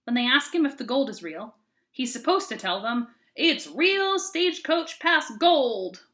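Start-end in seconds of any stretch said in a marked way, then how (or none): 3.4-6.0 slow, higher, louder hammed-up Southern accent; drawn-out cheesy Southern tour-guide accent. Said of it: "with a cheesy Southern accent".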